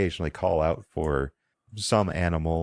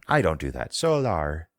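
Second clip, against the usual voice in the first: ordinary delivery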